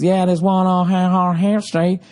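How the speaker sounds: teasing Southern accent impression. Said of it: "I just like the Southern accent".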